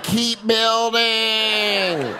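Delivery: slow drawn-out foreman order; a lazy site foreman barking slowly; shitty construction foreman. Retold or imitated. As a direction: boss the workers lazily, drawn out. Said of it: "I'm like a shitty construction foreman".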